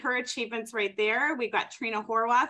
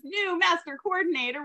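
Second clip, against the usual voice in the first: ordinary talk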